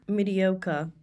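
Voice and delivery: "Mediocre." ending in a light "ka" sound; mediocre, r dropped. Shown as a demonstration. Asked by her co-host, she models the word with the R dropped. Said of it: "Can you say that again?".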